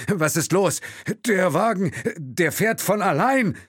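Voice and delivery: high, loud, incredulous exclamation; disbelieving alarm. Acted. Chauffeur finding the car drives itself, alarmed disbelief. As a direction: cry out as if it can't be true. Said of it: "Will hörte Unglauben in seiner Stimme".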